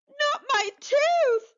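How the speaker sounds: high, slow wail of horror; dismayed cry. Acted. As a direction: cry out in shock at the loss. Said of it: "Bucky cried".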